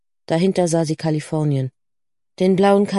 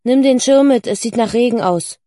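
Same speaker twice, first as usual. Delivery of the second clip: raised, louder parental reminder; calling out; 4 semitones higher, 5 dB louder than usual. A narrator's reading. Mother calling from the living room about the umbrella, raised voice. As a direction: call it through to the next room. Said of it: "rief ihre Mutter aus dem Wohnzimmer".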